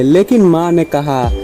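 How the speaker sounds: plain reading voice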